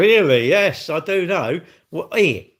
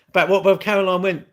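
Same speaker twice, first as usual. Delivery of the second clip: usual speaking voice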